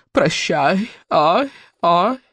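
a mild hint of a sobbing, fading echo. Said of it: "и зарыдало, хрипя и захлёбываясь".

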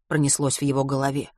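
usual speaking voice

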